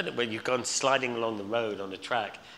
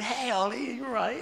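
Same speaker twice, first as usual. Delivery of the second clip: wife's voice, lightly pitched up and higher; a hint of the wife, higher and sing-song; imitating wife; 14 semitones higher than usual. Wife visiting him in hospital.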